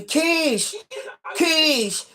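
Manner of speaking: high calling yell of a name across the street; mock yell. Janitor yelling after her on the street, high mock call. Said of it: "janitorial man was all on North Main Street yelling".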